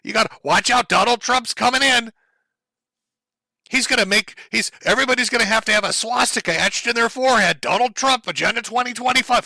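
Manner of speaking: over-the-top alarmist parody; mock hysteria. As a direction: ham up the fear. Continues mocking alarmist narrative.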